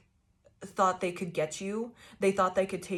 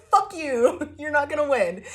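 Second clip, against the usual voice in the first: higher, louder defiant yell through laughter; mock-triumphant laughing shout; over the top; 7 semitones higher, 6 dB louder than usual. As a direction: taunt it gleefully, laughing. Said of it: "over the top about it".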